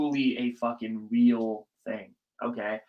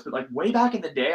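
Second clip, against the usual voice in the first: usual speaking voice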